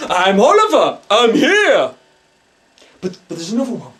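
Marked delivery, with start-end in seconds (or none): 0.1-1.9 high, loud, bouncy cry; sprightly midget spirit. Retold or imitated. Psychic voicing the ghost Oliver, a sprightly excited shout. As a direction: shout it like a happy little ghost. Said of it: "runs around and goes".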